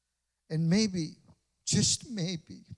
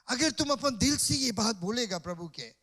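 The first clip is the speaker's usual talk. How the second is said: usual speaking voice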